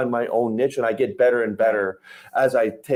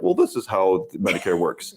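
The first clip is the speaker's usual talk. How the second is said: lower, lecturing know-it-all; condescending; 5 semitones lower than usual. Retold or imitated. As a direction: talk down to the client. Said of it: "very condescending".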